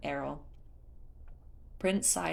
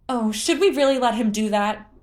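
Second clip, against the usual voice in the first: high, loud worried cry; alarmed, watching him climb; 6 semitones higher, 9 dB louder than usual. Acted. Tula watches the prince scale the tower, high and alarmed.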